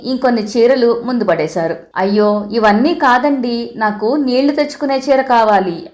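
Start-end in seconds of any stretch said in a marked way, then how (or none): none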